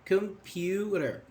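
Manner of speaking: "Computer" drawn out in separate syllables; broken into syllables. Shown as a demonstration. Teacher demonstrating a multisyllable word, "Computer" slowly split into its syllables. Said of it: "you will run out of your breath".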